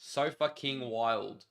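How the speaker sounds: slow, separated "So fucking wild"; sounding it out. Shown as a demonstration. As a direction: read "So fucking wild" piece by piece, not yet understanding. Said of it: "Like, oh, that one I get".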